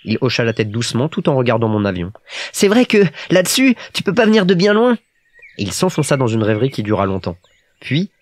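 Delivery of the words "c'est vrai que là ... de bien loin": put-on high little-boy voice, thinking aloud; musing Petit Prince. A narrator's reading. Little prince sizes up the pilot's plane, high and musing. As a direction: reason it out like a small child.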